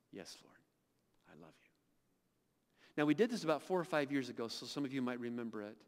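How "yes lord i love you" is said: much softer and lower, nearly gone; faint reply. Retold or imitated. Peter answering Jesus the third time, barely audible. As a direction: let Peter's answer fade almost to nothing.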